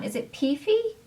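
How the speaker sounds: normal talking voice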